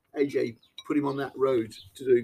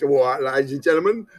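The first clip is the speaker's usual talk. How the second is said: mock Cockney; 2 semitones lower, 6 dB louder than usual. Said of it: "he put on this kind of Cockney persona".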